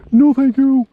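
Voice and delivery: high, loud squeal of disgust. Real, not acted. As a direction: squeal it out in horror. Recoiling from snake idea.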